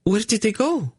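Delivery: high alarmed question; startled woman asking. A narrator's reading. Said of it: "Zinedra gasped".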